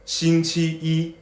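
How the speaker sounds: steady 星期一, every beat the same; 星期一 drilled evenly; even first tones. Shown as a demonstration. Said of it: "both first tones".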